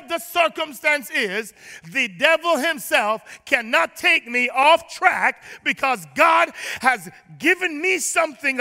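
full-voiced sermon preaching. Preacher rousing the congregation, full-voiced preaching.

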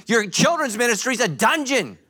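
retold outburst at the old church; exasperated rant. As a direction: tell them off in disbelief. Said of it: "I'm like".

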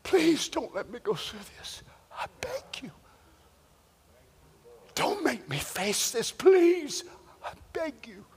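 hushed, tearful-style pleading; acted desperate plea, voice falling away; begging. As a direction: beg softly, dropping almost to a whisper. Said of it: "crying and calling out on Spirit God and begging".

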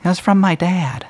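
put-on high child voice; little boy. Boy tells strangers where his teddy came from, in a high child voice. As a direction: answer shyly like a small boy.

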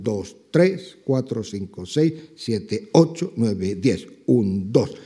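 a teacher clapping out compás by voice; marking the accents. Shown as a demonstration. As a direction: count "dos, tres ... diez, un, dos", landing on the accented beats. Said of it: "en el cual se marca un".

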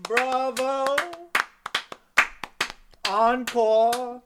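loud mock ovation; cheering bravo. Real, not acted. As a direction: call it out like from the stalls. Cheering after the scene.

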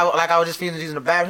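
ordinary talk

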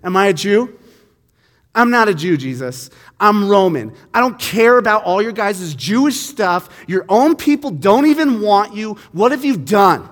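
loud, cutting scorn; cruel and harsh. Retold or imitated. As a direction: snap it at him with contempt. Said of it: "very cruelly".

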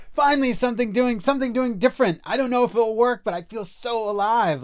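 high, loud, breathless elation; thrilled friend. Retold or imitated. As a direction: gush like someone finally set free. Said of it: "She's like".

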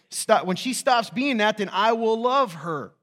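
loud, high, drawn-out proclamation; loud conditional declaration. Acted. Preacher voices a husband setting terms for love, loud and insistent. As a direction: belt it out slowly, word by word.

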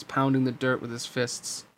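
usual speaking voice

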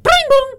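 very high, loud doorbell chime; buzzer sound. Pretend. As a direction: shriek a two-note buzzer. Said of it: "Let's hear your buzzers".

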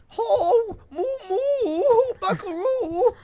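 put-on very high, loud Swedish-accented mooing; Swedish cow. Doing regional cows, high-pitched Swedish cow. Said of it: "Sweden would be like".

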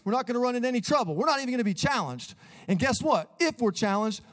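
high, overeager locker-room speech; mock cheery coach. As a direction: play a clueless, overly chipper coach firing up his team. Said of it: "he's like".

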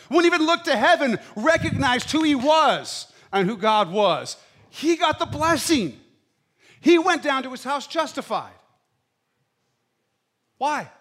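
loud, high, impassioned preaching; preaching at full force. Acted. Pastor driving the point home, full-voiced sermon. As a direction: pastor pounding home the parable to his congregation, fiery preaching.

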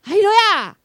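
high, loud hallelujah cry; exultant revival shout; joyful shout. Retold or imitated. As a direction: burst out praising. Shows how they jump up.

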